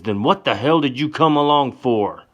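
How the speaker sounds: high, loud furious question; loud, harsh challenge; angry demand. Acted. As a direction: spit it right in her face. Johnny in her face, angry.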